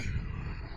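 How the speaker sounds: an unvoiced, breathy yawn mid-sentence; real yawn. Real, not acted. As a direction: let a tired yawn slip out. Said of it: "Just yawning".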